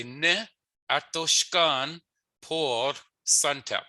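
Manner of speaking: Ne atoshkan por Suntep spoken slowly; slow Michif phrase. Shown as a demonstration. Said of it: "So you would say".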